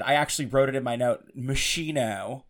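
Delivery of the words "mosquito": "machino" said slowly with a "ch" sound; imitating Tamara's "machino", slow; mocking her mispronunciation "machino"; mispronounced. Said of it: "as fucking Tamara said".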